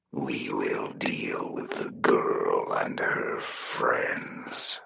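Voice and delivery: slow, whispered, otherworldly chorus voice; a hollow many-voiced whisper, dragged out slow. Victor vowing revenge on the girl in a slow, eerie many-voiced whisper. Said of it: "his voice the sound of many".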